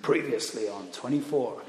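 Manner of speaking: lightly voiced low TV-trailer man; a hint of a gruff drama announcer; Kiefer Sutherland. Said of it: "Kiefer Sutherland's voice that says".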